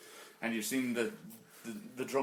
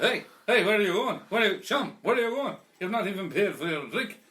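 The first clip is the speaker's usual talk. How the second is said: high, loud call after someone leaving; 9 semitones higher, 9 dB louder than usual. Acted. Drunk yelling after his friend walking out of the pub, high and loud. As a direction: shout after him as he heads for the door.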